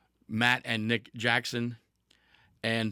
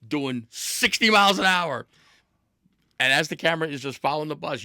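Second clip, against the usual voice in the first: excited build; 5 semitones higher, 7 dB louder than usual. Real, not acted. Excited telling of bus crash.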